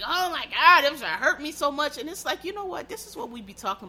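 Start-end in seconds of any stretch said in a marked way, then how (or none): 0.0-2.0 mock-wounded wail, much louder; mocking hurt gasp. Mocking offended people crying over a taunt, hammed-up hurt. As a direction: ham up their hurt feelings as a parody. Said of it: "these people was like".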